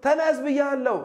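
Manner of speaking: high-pitched mock parental command; insistent father. Father ordering a child to get up, high and insistent. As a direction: raise the pitch sharply, demanding to be obeyed.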